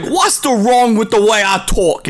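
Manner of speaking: high, loud mock Scouse accent; a hammed-up Scouse guess; imitating a Liverpool Scouse accent. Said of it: "Is it like, like that?".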